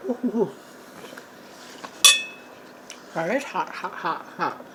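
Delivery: puffing around a hot mouthful; mouth full of hot food. Real, not acted. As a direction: talk through scalding food. Food too hot, just tasted.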